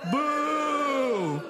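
laughing boo to the crowd. Acted. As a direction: boo along with them. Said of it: "everyone said".

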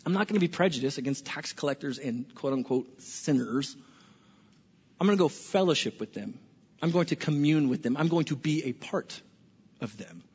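quietly firm, sinking to a hush; Jesus resolved. Retold or imitated. Jesus deciding to go after Levi, low soft resolve. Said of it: "he says".

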